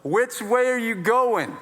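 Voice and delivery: high, slow, called from the back; unhelpfully kind bystander. Retold or imitated. Someone at the back to frantic father, kindly unhelpful. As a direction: call out a well-meaning, useless question slowly. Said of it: "trying to be very kind but ultimately super unhelpful".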